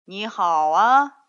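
slow, loud, courteous hello; drawn-out, bowing sort of politeness; polite lion greeting. A narrator's reading. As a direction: greet grandly like a well-mannered lion. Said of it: "他用礼貌的狮子的方式鞠着躬说".

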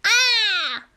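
high loud cry of falling; startled yelp. Acted. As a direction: yelp as you slip. Fox slips and falls.

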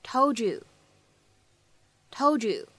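"told you" with d and y merged into a ch sound, tol-chu; blended told you. Shown as a demonstration. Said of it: "我们呢可以变成told you".

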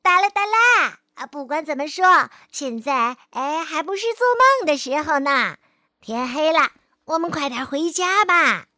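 loud, high happy call; shouting. A narrator's reading. Zuofu running ahead shouting to Fei'er, loud and happy. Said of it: "大声喊".

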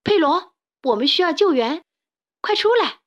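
loud, high urgent call; shouting. Acted. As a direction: yell for him to come out. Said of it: "卡梅利多喊道".

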